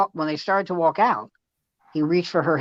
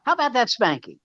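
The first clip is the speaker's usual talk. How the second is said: mock cheerful; 10 semitones higher, 4 dB louder than usual. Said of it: "I go".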